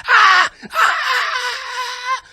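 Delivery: short scream, then a long high one; shocked screaming. Acted. Comedian sees two dudes and a kid, screaming in mock horror. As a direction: scream bloody murder, hammed up. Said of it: "I'm screaming my head off".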